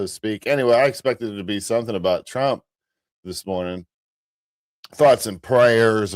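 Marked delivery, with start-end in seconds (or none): 4.9-6.1 slow, drawn-out thoughts and prayers; slow ironic solemn tone; mock solemn.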